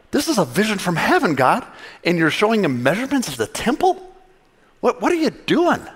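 rising to a high, baffled "what are you doing"; baffled outburst. Retold or imitated. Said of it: "and I was like".

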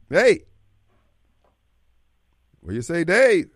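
usual speaking voice

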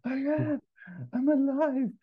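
high, strained character voice; wounded man pleading high down the phone; Mustafa. Acted. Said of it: "Ferrell as Mustafa at the wreckage".

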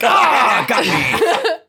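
high, loud hammed-up yell; mock scream. Acting out how people are reacting, loud mock scream. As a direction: cry out as if caught.

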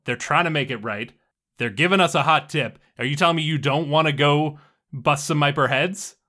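fed up, pressing the argument hard; exasperated scolding. Acted. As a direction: tear into a stubborn teammate. Scolds Butcher in an acted quarrel.

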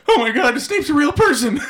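loud, very high excited outburst; mock amazement. Reader discovering Snape's past, hammed-up astonishment. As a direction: exclaim it high and loud, breaking into laughter. Acted reader's sudden realization.

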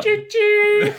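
high loud choo-choo call; train whistle voice. Acted. Hype train joke.

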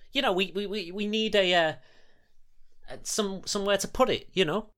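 high, eager, pushing the idea; eager character voice. Acted. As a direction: talk him into it brightly.

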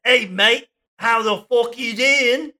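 high, put-on Aussie greeting; hammed-up Australian mate on the phone; Australian accent. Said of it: "Oh, wait, we're Australian".

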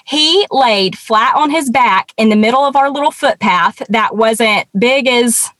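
normal talking voice

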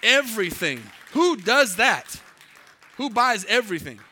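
loud, high, incredulous exclamation; amazed disbelief. Real, not acted. Preacher marvelling to congregation at the buyer, amazed. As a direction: marvel loudly, drawing out the question.

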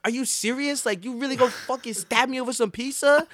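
put-on high, incredulous older brother; hammed-up are-you-kidding-me; imitating a shocked brother; mock disbelief. Retold video, brother in disbelief.

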